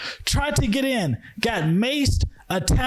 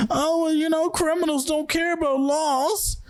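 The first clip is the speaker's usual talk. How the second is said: higher, mocking whine of a gun-rights argument; mocking gun defenders; 7 semitones higher than usual. Ranting about gun laws, mocks the critics' higher sneering excuse. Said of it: "And that's all people are asking for".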